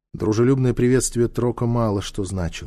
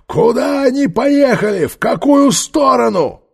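high, loud shout in her face; 13 semitones higher, 7 dB louder than usual. A narrator's reading. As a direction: yell a demand at the prisoner. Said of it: "закричал в её испуганное лицо".

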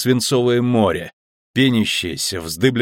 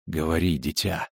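soft whisper; 4 semitones lower, 8 dB quieter than usual. A narrator's reading. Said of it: "шепнула волшебница".

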